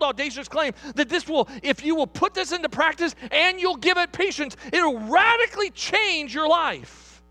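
high, urgent sermon voice; impassioned preaching. Real, not acted. Preacher promising friends it will radically change your life, impassioned.

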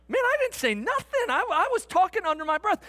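high-pitched innocent denial; mock indignant protest. Fan denying he said anything, in high mock protest. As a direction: jump up high in wounded disbelief. Acted indignant self-defence in thought.

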